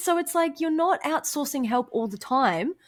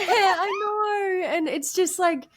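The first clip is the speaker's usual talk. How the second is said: choked up; 10 semitones higher than usual. Real, not acted. Emotional reply to friend's praise.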